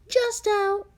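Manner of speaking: high, loud call over the shoulder; called out to dad, high; calling back. A narrator's reading. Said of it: "I called back".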